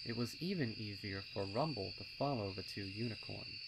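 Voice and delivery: ordinary delivery, nothing marked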